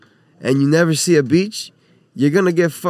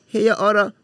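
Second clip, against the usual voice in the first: higher-pitched imitation Chinese accent; mock Chinese accent; 5 semitones higher than usual. Chinese lady calling out to a diner, mock Chinese accent. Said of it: "give me that accent one more time".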